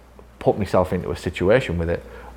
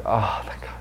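relieved whisper; 4 dB quieter than usual. Retold or imitated. Imitating relieved patient.

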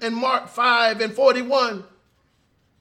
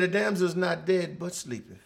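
soft assurance; 6 semitones lower, 8 dB quieter than usual. Acted. Jesus speaks gently.

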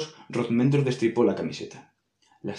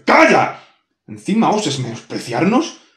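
loud, high furious shout; enraged boss roar; 5 semitones higher, 8 dB louder than usual. Acted. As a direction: blast her into silence. Demon explodes at insult.

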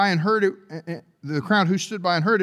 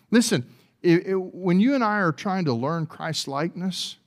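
normal talking voice

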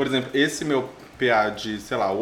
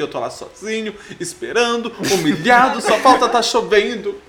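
building self-pity; 8 semitones higher, 5 dB louder than usual. Real, not acted.

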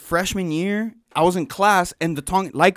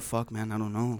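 quiet helpless shrug; 8 semitones lower, 11 dB quieter than usual. Retold or imitated. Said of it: "he literally said".

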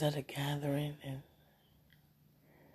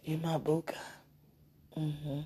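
usual speaking voice

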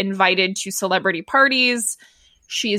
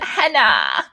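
nasal voice. Retold or imitated. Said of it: "Tracy's nasal voice imitated".

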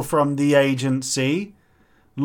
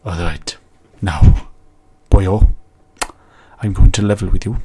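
low, loud, deliberate put-on voice; stern adviser character; 6 semitones lower, 7 dB louder, faster than usual. Adviser levelling with the client, low, forceful character voice. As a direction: drop deep and lay down the law.

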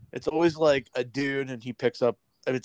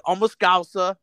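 mock Scouse accent, higher; Paddy Pimblett; 4 semitones higher, 4 dB louder than usual. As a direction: imitate a Liverpool fighter's Scouse. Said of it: "Paddy Pimblett".

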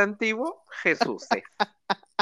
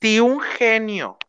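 slow; 3 semitones lower, slower than usual. Pretend. Pregunta de reina de belleza.

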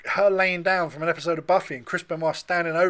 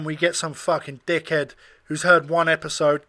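normal talking voice

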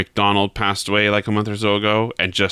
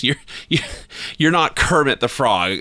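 disbelieving bank teller; 6 semitones higher than usual. Acted. Teller doubts the frog's name.

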